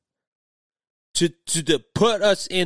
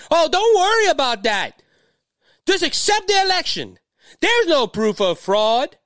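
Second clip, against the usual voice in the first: higher-pitched mocking brush-off; mock dismissive media; 8 semitones higher than usual. Media brushing off voters' questions about fraud, mock dismissive. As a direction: imitate the media waving away every question. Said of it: "when the media tells us to".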